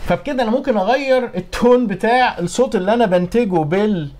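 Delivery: ordinary talk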